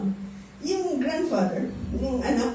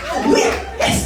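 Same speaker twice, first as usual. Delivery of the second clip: joyful shout of praise; 6 dB louder than usual. Real, not acted. Exuberant praise after laughter.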